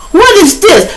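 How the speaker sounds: shrieking very high, loud and stunned; freaking out. Retold or imitated. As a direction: lose it at the sight of the gun. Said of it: "man, he tweaking".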